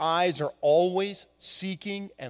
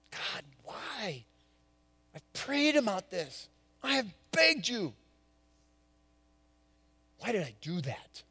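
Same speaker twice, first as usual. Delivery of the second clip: much softer, broken plea to God; anguished prayer; 9 dB quieter than usual. Retold or imitated. Peter prays in remorse after denial, hushed anguish. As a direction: beg God with quiet remorse. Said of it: "the question".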